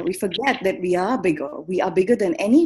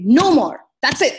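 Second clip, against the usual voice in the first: higher, louder declaration of enough; acted firm refusal; 4 semitones higher, 6 dB louder than usual. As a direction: put your foot down emphatically. Acts out reaching one's limit.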